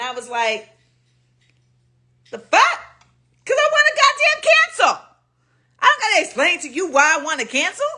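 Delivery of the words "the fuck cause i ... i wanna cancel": very high, disbelieving squawk; incredulous outburst. Retold or imitated. Answering the pushy customer, high incredulous outburst. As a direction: go way up in outraged disbelief.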